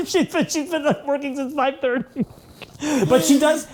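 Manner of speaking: talking through high laughter; laughing. Real, not acted. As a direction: laugh it out, amused. Teasing that wife works constantly.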